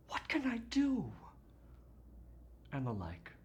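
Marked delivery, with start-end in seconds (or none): 0.1-1.1 high mock-helpless wail; mimicked lament. Doctor quoting the family's talk of love, mimicked lament. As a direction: pitch it way up like a helpless sigh of love.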